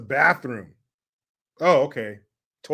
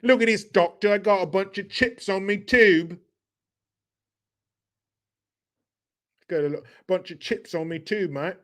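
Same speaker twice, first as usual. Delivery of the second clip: hammed-up working-class British; exaggerated fake British mate-talk; mock British accent; 4 semitones higher than usual. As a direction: play a British bloke showing the doctor. Playing British patient with slang.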